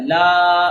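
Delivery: لا held long and loud; long vowel. Shown as a demonstration. Teacher models alif madda, لا drawn out. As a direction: stretch the aa of لا. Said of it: "آپ نے اس کو لمبا کرنا ہے".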